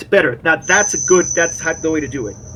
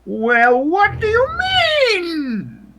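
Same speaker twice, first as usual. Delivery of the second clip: loud, screechy nurse climbing in pitch; screechy rising pitch; 5 dB louder, much slower than usual. Acted. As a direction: screech it, sliding sharply upward in indignation. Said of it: "Nurse Ogilvy's rather screechy, uh, voice, uh, rising in pitch".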